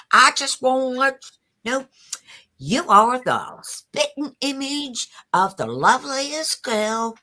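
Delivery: put-on Southern accent. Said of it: "may I do your voice in Southern?".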